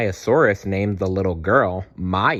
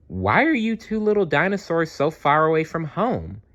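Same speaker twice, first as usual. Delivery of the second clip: kind stegosaurus; 8 semitones higher than usual. Acted. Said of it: "asked the kind stegosaurus".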